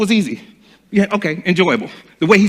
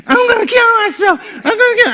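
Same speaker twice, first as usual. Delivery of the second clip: much higher, half-crying shout; mock hysterical threat. [name] threatening suicide, in a high mock crying wail.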